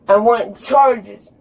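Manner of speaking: ordinary talk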